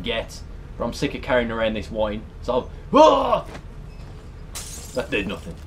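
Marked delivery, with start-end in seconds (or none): none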